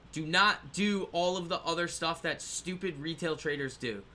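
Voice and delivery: ordinary delivery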